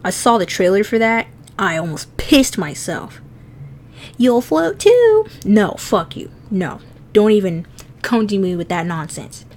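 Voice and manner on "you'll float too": high, slow, drawn-out creepy clown voice. Retold or imitated. Quoting the scary movie trailer, high drawn-out clown voice. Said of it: "You know, the clown?".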